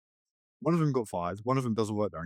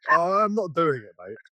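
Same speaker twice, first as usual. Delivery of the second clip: louder, cocky refusal to the teacher; defiant retort; 4 semitones higher, 7 dB louder than usual. Retold or imitated. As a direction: push back like a cheeky student.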